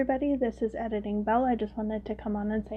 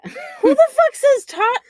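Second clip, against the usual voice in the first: loud, high-pitched disbelief; incredulous outburst; 14 dB louder, slower than usual. Real, not acted. Host reacting to cohost's toddler story, incredulous shout.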